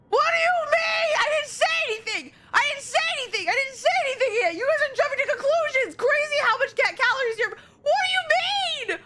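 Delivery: outraged shouting. Real, not acted. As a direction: yell it in indignant protest. Defends himself against chat, yelling.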